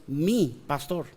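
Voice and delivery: hushed, intimate "mi pastor"; stress on mi. Shown as a demonstration. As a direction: make "mi pastor" personal, weight on "mi". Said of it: "pero es diferente cuando es".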